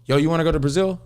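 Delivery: high, eager quoted pitch; retold excited invitation. Friend inviting him to Brazil, excited. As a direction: pitch it up like a pal with a plan.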